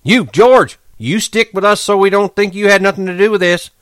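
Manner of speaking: high, loud shout; Curly shouting back for George, high and loud; called out. A narrator's reading. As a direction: call across the barn. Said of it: "until Curly called".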